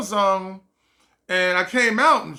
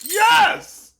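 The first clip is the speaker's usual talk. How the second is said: much higher, louder cheer of relief; triumphant cheer; 13 semitones higher, 5 dB louder than usual. Retold or imitated. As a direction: shout it like you just won. Finally finds the key.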